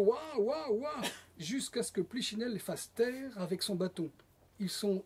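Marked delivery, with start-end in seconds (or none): 0.1-1.0 high mock baby wail; repeated storyteller's baby cries; baby howling. A narrator's reading. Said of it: "Le bébé hurle".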